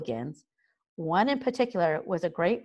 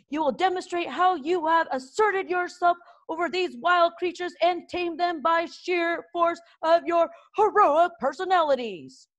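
gruff drill master; 10 semitones higher than usual. A narrator's reading. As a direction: lord it over the trembling boys. Gobber explains the test.

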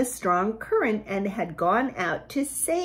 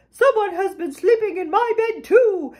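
higher, indignant mama bear cry; 9 semitones higher, 4 dB louder than usual. A narrator's reading. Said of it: "Mama Bear cried".

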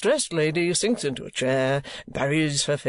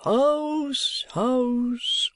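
high, drawn-out call; crying out; 11 semitones higher than usual. A narrator's reading. Folair hailing the house from outside, high drawn-out cry. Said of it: "cried Mister Folair".

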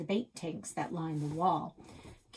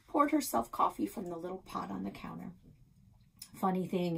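ordinary delivery, nothing marked

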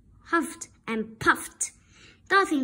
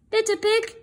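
higher, louder calling wolf; 7 semitones higher, 4 dB louder than usual. Acted. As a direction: call out sweetly to lure the pig in. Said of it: "he called".

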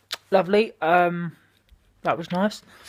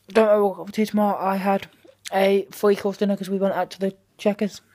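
usual speaking voice

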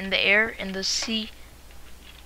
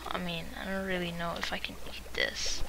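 whispering; 3 semitones lower, 7 dB quieter, faster than usual. Real, not acted. Unsure muttering.

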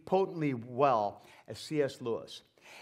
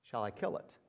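quieter, lower solemn question; quiet angel; 7 semitones lower, 10 dB quieter than usual. Acted. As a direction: ask it gravely as the angel. Angel asks, low and quiet.

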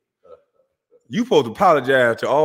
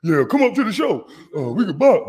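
imitating the rival; 5 semitones higher than usual. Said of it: "fake Weasel Tough motherfucker".

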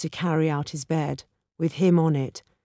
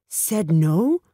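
shocked echo, rising steeply at the end; voice breaking in disbelief; 3 dB louder than usual. A narrator's reading. Bhatia appalled by what his friend tells him, voice breaking. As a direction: repeat it as an incredulous question. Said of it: "it was Bhatia's voice that broke".

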